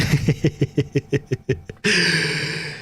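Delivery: long, high staccato laugh. Real, not acted. Streamer reacting to "Are you Mexican?", real staccato laughter. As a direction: laugh helplessly in a long run.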